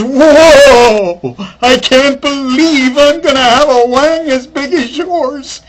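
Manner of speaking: loud, high, thrilled cry; the guy can't contain his glee; excited whoop. Retold or imitated. Guy excited during the act.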